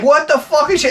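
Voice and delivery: high-pitched incredulous cry; mock alarm. Husband sees drunk wife firing mortars, high mock alarm. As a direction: yelp it in comic disbelief.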